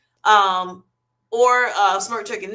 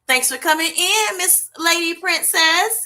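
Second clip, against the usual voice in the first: sing-song welcome; 7 semitones higher than usual. Real, not acted. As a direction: welcome her like a favourite guest. Greeting a viewer by name.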